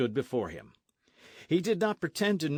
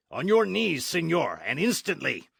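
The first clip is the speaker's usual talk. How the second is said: loud, much higher command; 7 semitones higher, 6 dB louder than usual. Acted. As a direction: order the captain down with force. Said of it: "Signor Zorro commanded".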